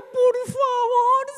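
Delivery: high, drawn-out tearful begging; wailed plea. Retold or imitated. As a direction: wail it out slowly, begging for rescue. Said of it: "Empecé a gritar".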